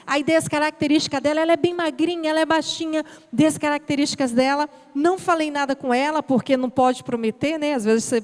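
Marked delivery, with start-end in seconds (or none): none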